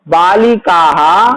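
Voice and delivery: बालिकाः slowed down with the visarga sounded as "हा"; slow visarga demo. Shown as a demonstration. Teacher demonstrating visarga to pupils, slow and deliberate. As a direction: stretch बालिकाः, ending on an audible "हा". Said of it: "इस तरह से हम करते हैं ना विसर्ग".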